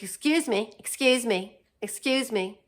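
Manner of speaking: loud repeated excuse me, falling each time; insistent mock Karen. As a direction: imitate a pushy woman calling for attention. Pushy stranger demands attention.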